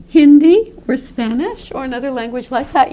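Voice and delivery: normal talking voice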